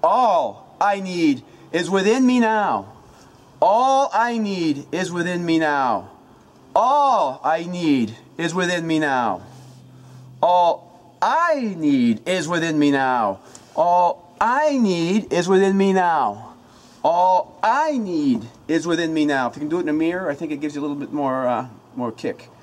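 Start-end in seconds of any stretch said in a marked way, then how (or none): 0.0-9.4 loud, slow incantation with "All" punched high and held; shout, stress on All. Shown as a demonstration. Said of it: "I'm gonna change the tone of each word". 10.4-19.5 loud, slow incantation with "I" punched high and long; shout, stress on I. Shown as a demonstration. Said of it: "the emphasis of each word".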